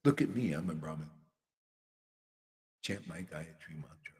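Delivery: low, soft, fast smug boast; low muttered mock boast; smug brahmin mimic. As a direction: mutter it smugly to yourself. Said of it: "'cause I say".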